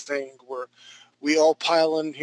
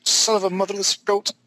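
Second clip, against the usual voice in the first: mock frustration; 5 semitones higher, 3 dB louder than usual. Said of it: "I can hear the frustration in your".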